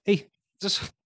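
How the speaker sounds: high-pitched Scouse accent; Jamie Carragher. Shown as a demonstration. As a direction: drop straight into high Scouse. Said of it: "immediately just drops into, like, Jamie Carragher Scouse".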